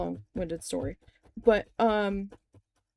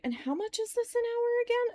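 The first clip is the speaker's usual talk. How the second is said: much higher, pointed disbelief; incredulous question; 13 semitones higher than usual. Retold or imitated. Asking the interviewers about pay, high incredulous pitch. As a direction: ask it like you can't believe it. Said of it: "And then I was like".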